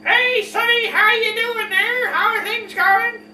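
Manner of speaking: put-on high-pitched, drawn-out parody of an old woman; silly old-woman voice. Shown as a demonstration. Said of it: "creating stupid voices like".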